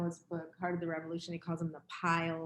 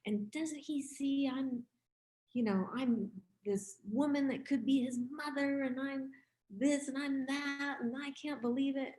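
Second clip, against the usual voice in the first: higher-pitched, offended disbelief; mock-indignant elder fuming at a young man; indignant inner voice; 7 semitones higher than usual. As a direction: replay the outrage, a bit hammed-up. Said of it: "in my mind, I was thinking".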